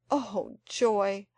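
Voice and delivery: plain reading voice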